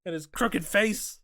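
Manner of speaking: slow, high, loud mock insult; Don the Dragon Wilson. Kickboxer mocking his rival, drawn-out taunt. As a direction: stretch the insult out in a comic yell. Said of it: "Don the Dragon Wilson was up here like".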